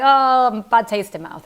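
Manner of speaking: a buyer turned off by the sudden discount; mock disgusted brand. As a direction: sound let down and suspicious. Brand reacts to the price drop.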